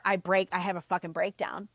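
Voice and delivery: ordinary talk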